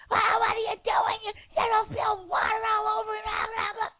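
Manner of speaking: high-pitched hammed-up screech; mock screaming. Retelling a wife screaming at husband over spilled water, mock screaming. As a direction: imitate her frantic screaming, very high. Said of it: "she started screaming at him".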